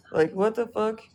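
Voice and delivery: ordinary talk, nothing marked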